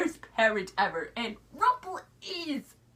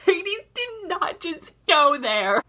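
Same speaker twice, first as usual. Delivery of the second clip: slow, louder, high-pitched outrage; shocked disbelief; 4 semitones higher, 5 dB louder, slower than usual. Retold or imitated. Viewer reacting to Hades at the episode's end, shocked. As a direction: stretch it out, scandalised.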